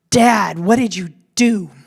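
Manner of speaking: loud, slow call from the porch; grandma calling out to grandpa; scolding call. Retold or imitated. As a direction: demand an answer across the yard.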